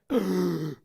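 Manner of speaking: sudden acted gasp of realization; shocked gasp. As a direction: gasp as if it just hit you. Mother realizes ring is lost.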